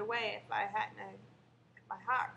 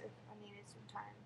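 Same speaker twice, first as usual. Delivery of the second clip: whispering; 12 dB quieter than usual. Real, not acted. Whispered while recalling panic attacks.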